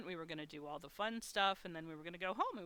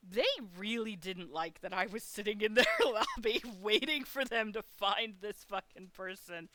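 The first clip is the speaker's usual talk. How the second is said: chuckling through the words; laughing while talking; 4 dB louder than usual. Real, not acted. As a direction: let a laugh slip into the line. Laughing while telling story.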